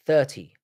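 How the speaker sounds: "Thirty" with a clear th at the start; careful th. Shown as a demonstration. London streamer repeating it for chat, careful th. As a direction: pronounce "Thirty" with a proper th. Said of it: "how I say thirty".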